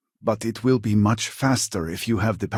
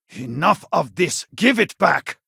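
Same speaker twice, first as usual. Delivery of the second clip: angry shout; 9 semitones higher, 5 dB louder than usual. Acted. Confronting a thief.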